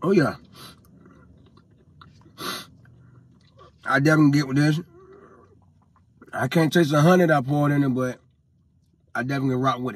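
chewing between words; talking while eating; mouth full of soup. Real, not acted. Seen 1: spoon at lips at 0.1, chewing face while stirring cup.

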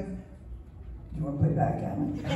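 slow, low, breathy whispered offer; mock whisper to the cat. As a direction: whisper it like a doomed invitation to a cat. Asking the needy cat to play.

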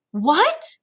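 high, rising incredulous question; disbelieving mother. A narrator's reading. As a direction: ask it as if you can't believe it. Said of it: "like she couldn't believe it".

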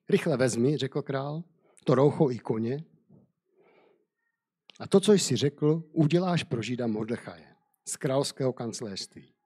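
steady storyteller's reading voice; measured Bible story narration. Acted. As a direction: read the tale aloud to listeners. Reads Esther story aloud.

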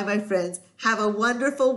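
usual speaking voice

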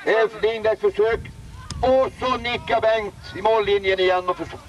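fast, high, excited match commentary; live play-by-play. Acted. Commentator calling the game to listeners, excited play-by-play. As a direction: call every pass like it's a cup final.

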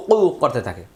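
ordinary delivery

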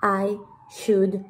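"I... should... speak" with gaps between words; very slow, careful word-by-word English; slow model sentence. Shown as a demonstration. Teacher translating the hablar example, slow model sentence.

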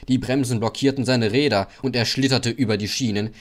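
plain reading voice

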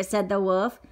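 usual speaking voice

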